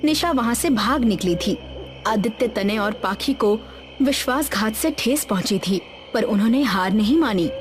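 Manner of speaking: plain reading voice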